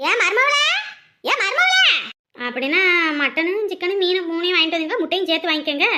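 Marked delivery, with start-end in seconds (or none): 0.1-0.7 raised call to daughter-in-law, rising at the end; mother-in-law calling out, pitched up. Acted. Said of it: "நான் எம்புட்டு காத்து கத்துறேன் பாத்தியா". 1.2-1.9 high, insistent call to daughter-in-law; mother-in-law's high-pitched summons. Acted. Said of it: "நான் எம்புட்டு காத்து கத்துறேன் பாத்தியா".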